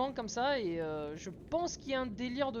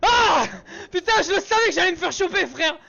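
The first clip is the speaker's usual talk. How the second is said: panicked screaming after a jump scare; scared horror game streamer; 8 semitones higher, 14 dB louder than usual. Real, not acted. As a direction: yell out in real fright. Jump scare, caught by monster.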